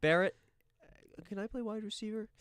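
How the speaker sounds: high-pitched teasing role-play voice; mock teammates. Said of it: "They were like".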